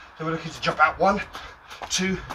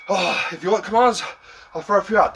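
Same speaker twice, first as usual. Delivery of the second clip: normal talking voice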